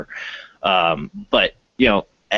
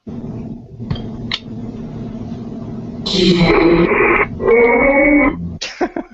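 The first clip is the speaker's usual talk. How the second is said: high-pitched laugh; 14 semitones higher than usual. Real, not acted. Shocked laughter at app saying name.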